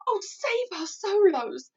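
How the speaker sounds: high, loud, slow wail; anguished cry. A narrator's reading. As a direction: wail in despair. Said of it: "he cried".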